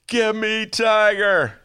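"Give me Tiger" high, loud, stretched slow and whining; whiny plea. Retold or imitated. Hooked viewer begging for Tiger King, whining. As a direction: drag out "Tiger" like a begging kid. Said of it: "I was like".

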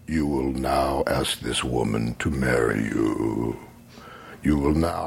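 deep slow oracle voice; commanding inner voice. Retold or imitated. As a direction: boom it out like a voice from the mountain.

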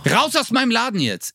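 retold outburst, high without much extra volume; mock angry order. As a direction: act out throwing someone out. Clerk orders him out of shop.

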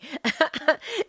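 short high laugh; a quick embarrassed laugh. Real, not acted. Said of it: "I got too excited".

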